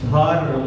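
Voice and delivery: ধর্ম said as one smooth word; said whole. Shown as a demonstration. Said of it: "প্রত্যেকটা". (ধর্ম একটানা উচ্চারণ)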